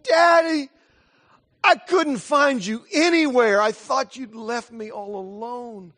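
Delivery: put-on high, loud, slowed wail of a frightened little girl; crying child. Father reliving his sobbing daughter calling for him, high anguished wail. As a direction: cry out like a child who thinks she's abandoned. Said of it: "she just cried out".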